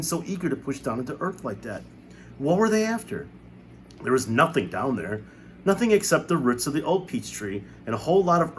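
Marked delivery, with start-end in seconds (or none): 2.4-3.3 put-on high, wondering young voice; a boy puzzling aloud; lightly voiced James, puzzled; curious boy. A narrator's reading.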